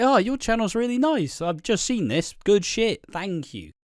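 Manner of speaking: high, bright gushing fan; a lightly voiced gushing viewer. Quoting viewer comments.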